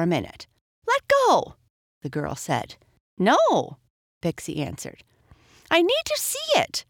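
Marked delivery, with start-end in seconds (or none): 0.9-1.6 put-on very high, loud girlish command; insistent order. A narrator's reading. Tug-of-war over the book. 3.2-3.8 high, loud refusal; firm refusal. A narrator's reading. Pixie refuses in tug-of-war. 5.7-6.8 put-on very high, insistent girl; demanding. A narrator's reading. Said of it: "the girl demanded".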